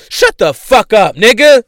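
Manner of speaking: loud, rising angry yell; real angry outburst; angry shout. Real, not acted. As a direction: snap at him, furious. Angry rant at [name].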